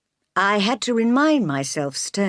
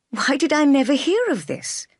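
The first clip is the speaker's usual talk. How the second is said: higher, astonished question; amazed; 8 semitones higher, 3 dB louder than usual. A narrator's reading. Jane learns of her uncle's letter, amazed and higher. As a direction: let the shock lift the line. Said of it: "I asked, amazed".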